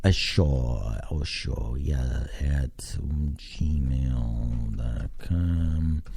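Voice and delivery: slow, low, soft drawl; absent-minded slow muttering; typing along. Real, not acted. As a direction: draw each word out as you type. Spelling out email while typing.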